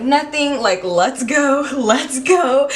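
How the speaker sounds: high, slow-then-laughing urging; nervous urging. Retold or imitated. Pulling her boyfriend away from the fight, high nervous urging.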